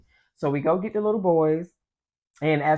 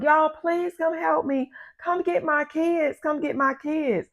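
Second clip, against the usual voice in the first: high, stretched-out cry for help; drawn-out high plea; imitated pleading; 11 semitones higher, slower than usual. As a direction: retell a desperate mother begging, pitched up. Neighbor begging for help, retold.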